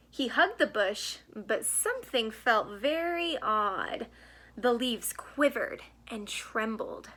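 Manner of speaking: gentle storybook read-aloud; reading to children. A narrator's reading. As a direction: read it like a bedtime picture book. Reads picture book to children.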